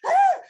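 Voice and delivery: loud, very high cry; emotional wail. Retold or imitated. Recalling hugging the stranger, a loud high wail. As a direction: let out a high overwhelmed wail.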